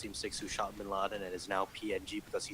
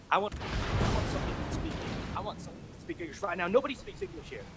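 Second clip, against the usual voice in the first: much higher, whining mock tirade; irate caller; 10 semitones higher, 4 dB louder than usual. Customer demanding someone who speaks English, irate imitation. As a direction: imitate a shrieky, fed-up customer.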